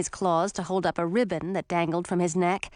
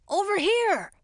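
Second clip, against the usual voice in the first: higher, alarmed call; shout; 10 semitones higher than usual. A narrator's reading. Townsman alerts the men to a dragon, shouting. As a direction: call the others over. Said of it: "someone shouted".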